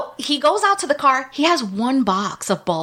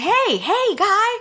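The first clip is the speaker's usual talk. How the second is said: high bright call from an upstairs window; 9 semitones higher, slower than usual. Retold or imitated. From the window, calling down to the electric company guy, high hailing call. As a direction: pitch up and call down to a stranger.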